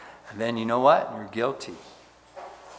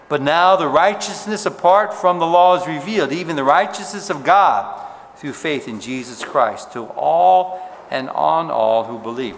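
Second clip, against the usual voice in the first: louder, lifted Bible reading; louder pulpit reading; scripture reading; 2 semitones higher, 4 dB louder than usual. Acted. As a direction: proclaim the verse from the pulpit. Reads Romans aloud.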